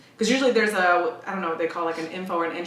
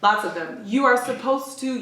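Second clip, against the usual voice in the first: ordinary talk, nothing marked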